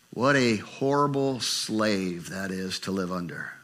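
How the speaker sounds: normal talking voice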